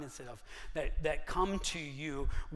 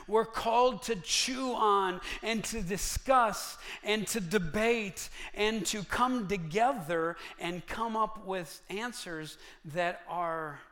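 preaching; 5 semitones higher, 7 dB louder than usual. Real, not acted. Preacher builds to full force.

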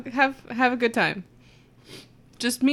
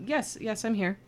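quiet; 8 dB quieter than usual. Real, not acted. Answering a hidden communicator.

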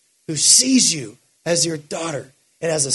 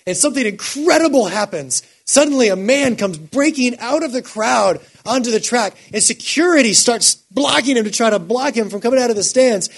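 normal talking voice

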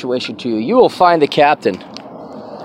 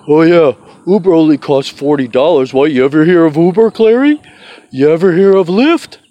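mocking critics; 2 semitones higher, 4 dB louder than usual. Host mimics people who push Uber on him, mocking. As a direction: sneer at the Uber fans. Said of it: "People say".

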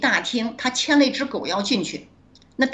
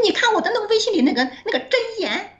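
classmate's voice; 12 semitones higher than usual. Retold or imitated. Classmate voiced in retold chat.

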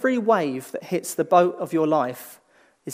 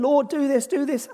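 mock pleading, pitched higher, "do this" repeated; pleading prayer; 8 semitones higher than usual. Preacher imitating people begging God in prayer, higher pleading. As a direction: whine it up like a begging pray-er. Mimicking impatient praying.

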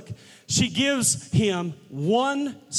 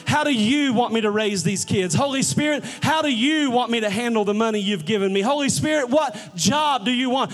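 raised, high-pitched sermon fervor; building pulpit intensity; impassioned preaching; 5 semitones higher than usual. Real, not acted. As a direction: preach it urgently, each appeal punched at the start.

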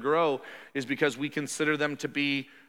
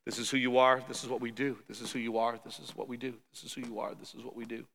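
low, trailing off softer with each line; fading recitation; 4 semitones lower, 7 dB quieter than usual. Acted. Preacher repeats how believers are instructed, low fading recitation. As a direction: recite it steadily, sinking away to very quiet.

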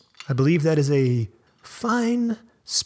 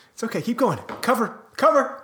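high, strained after a coughing fit; 9 semitones higher than usual. Real, not acted. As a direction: push words out through a cough. Coughing on tequila.

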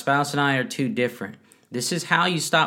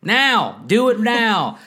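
sharp, high, louder demand, no patience; impatient demand; 9 semitones higher, 5 dB louder, slower than usual. Pretend. Mock praying spouse demands God act now, impatient and high. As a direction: insist it happens right now.

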